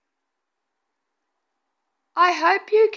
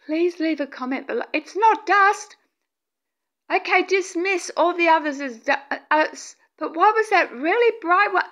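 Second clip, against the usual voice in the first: ordinary talk, nothing marked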